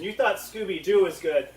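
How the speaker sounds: normal talking voice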